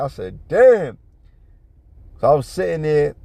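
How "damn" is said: loud, high exclamation of shock; stunned exclamation. Retold or imitated. Onlooker hearing how far she went, stunned. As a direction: act out being floored. Retelling his shock at her insult.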